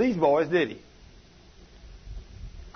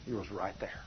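much softer and lower, almost breathed; hushed; 9 semitones lower, 15 dB quieter than usual. Real, not acted. Preacher softly assures the church Jesus is near, hushed. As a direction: drop to a near hush.

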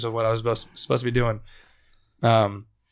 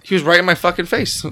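louder, higher, worked up; excited retelling; 6 semitones higher, 6 dB louder than usual. Real, not acted. Teller relives the scare to friends, worked up and loud. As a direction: tell it loud and agitated as if still shaken. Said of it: "I was scared".

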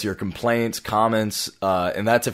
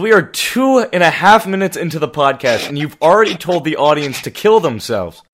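higher and louder, exasperated; exasperated scolding; 6 semitones higher, 6 dB louder than usual. Real, not acted. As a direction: scold him, half laughing. Scolding co-host on podcast.

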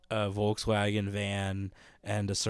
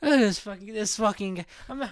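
high, put-on cranky old man; angry old heckler; 10 semitones higher, 3 dB louder than usual. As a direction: grumble back at the comic.

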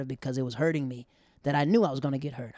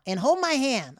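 put-on bossy girlfriend; 10 semitones higher, 8 dB louder, slower than usual.

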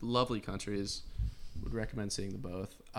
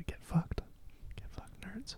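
hushed, breath-only taunt; playful whispered insult; mock whisper; 8 semitones higher, 8 dB quieter, slower than usual. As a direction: whisper it as a secret jab. Opening the secret whispered segment.

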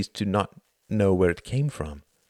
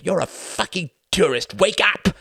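climbing to a high, loud yell; angry shout; 11 semitones higher, 5 dB louder than usual. A narrator's reading. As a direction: shout him awake in contempt. Reginald berating Tom.

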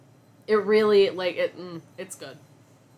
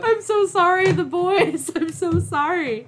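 laughing apology; 9 semitones higher, 4 dB louder than usual. Real, not acted.

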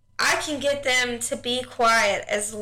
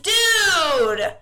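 exasperated call; 7 semitones higher, 4 dB louder than usual. Retold or imitated. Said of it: "And I'm like".

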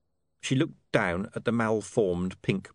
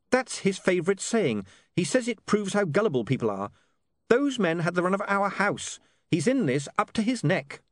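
lightly voiced William; 11 semitones higher than usual. A narrator's reading. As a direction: give the son an animated, raised pitch.

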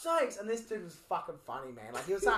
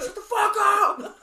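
high, loud, slow laughing yell; mock yell; 13 semitones higher, 12 dB louder, much slower than usual. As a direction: yell it long and mock-angry. Roommate yelling again.

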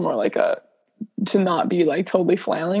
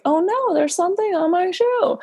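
high, hammed-up fake surprise; pretending to spot something on her shoe, mock high surprise; 10 semitones higher than usual. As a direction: act innocent, as if you just noticed.

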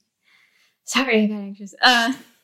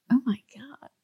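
whisper; 3 semitones higher, 3 dB quieter than usual. Retold or imitated. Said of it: "he goes".

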